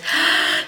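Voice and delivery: sudden high gasp; sharp gasp. Pretend. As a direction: suck in a quick, audible breath. Gasps before signing off.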